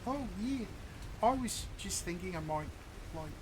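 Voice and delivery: put-on lightly done Kiwi accent; casual New Zealand accent. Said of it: "our characters were gonna have Kiwi accents".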